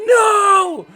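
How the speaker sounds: high, loud, drawn-out cry of dismay; anguished shout. Acted. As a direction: scream it out like a player who blew the shot. Acted player misses a shot.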